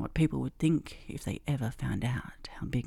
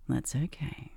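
usual speaking voice

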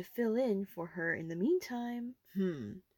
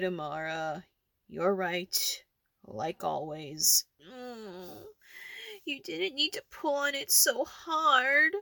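ordinary talk

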